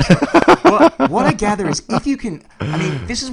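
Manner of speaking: high, loud laughter spilling into words; laughing. Real, not acted. Host laughing as he sums up to his guest. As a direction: laugh it off, then press on.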